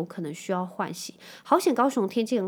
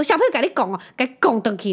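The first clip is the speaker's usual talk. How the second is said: loud egging-on grandma, higher; grandma tells Hunter to hit back, loud imitation; imitating grandma; 3 semitones higher, 7 dB louder than usual. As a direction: urge the kid on like a pushy grandma. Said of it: "我妈在旁边说".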